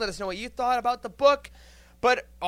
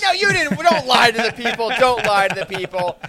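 mock scolding; 9 dB louder than usual. Host playfully scolds a co-host.

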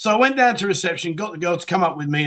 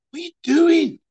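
high, disbelieving question; can't believe what she just did; incredulous high question; 11 semitones higher, 3 dB louder than usual. Retold or imitated. After she hangs up on her German boyfriend, incredulous high question.

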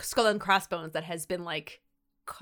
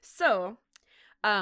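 ordinary talk

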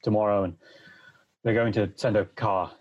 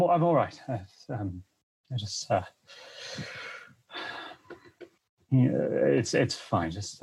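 hollow war-weary soldier; 3 semitones higher than usual. Acted. Shell-shocked soldier denies trauma.

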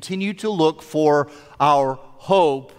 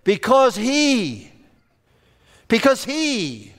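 slow, with "he" pitched up and drawn out; stress on HE; 3 semitones higher, slower than usual. Retold or imitated. Preacher giving the third-person answer, stress on "he". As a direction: land on "he" and let it hang.

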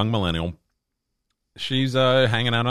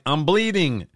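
put-on higher-pitched child's call; complaining kid imitation; 8 semitones higher than usual. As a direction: imitate a hurt kid calling for Mom. Hurt kid calls to mom.

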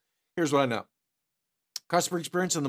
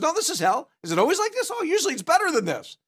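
cheery devil; 10 semitones higher, faster than usual. Acted. Voicing the devil in joke.

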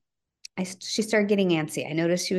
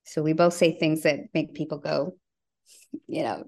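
ordinary talk

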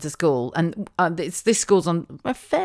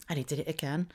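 normal talking voice

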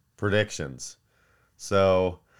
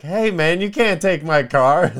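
much higher and louder, a whiny yell; mock protest; 10 semitones higher, 10 dB louder than usual. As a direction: yell it at the tow guy like a spoiled brunch guest. Florida owner protests car repo.

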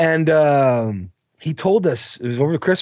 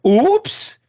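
mock oops; 11 semitones higher than usual. Fake accident, mock oops.

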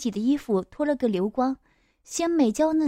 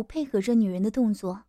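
ordinary delivery

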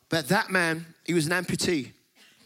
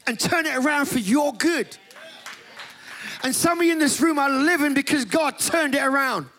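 preaching; 5 semitones higher than usual. Acted. Preacher telling the congregation their life is no mistake, fervent preaching. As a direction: drive the promise home to the room.

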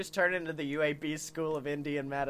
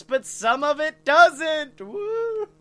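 louder, higher whoop at the end; mock cheer; 9 semitones higher, 9 dB louder, much slower than usual. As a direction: sell it like a hype man. Mocking Eastern medicine cheer.

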